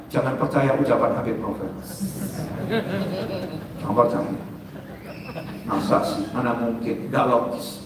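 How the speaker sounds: low, hushed lightly voiced tempter; a hint of a sly tempter, low and soft; tempting devil voice. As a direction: whisper doubts like a devil. Voicing Satan's whispers.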